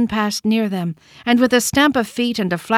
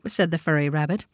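ordinary delivery

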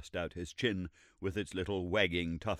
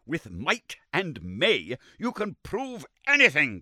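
slow, higher, louder scorn; furious; 8 semitones higher, 8 dB louder than usual. Acted. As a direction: spit it out in fury. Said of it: "cried Summerlee furiously".

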